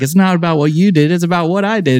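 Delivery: high gloating retort; triumphant gloating. Retold or imitated. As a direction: rub the win in playfully. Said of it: "I was like".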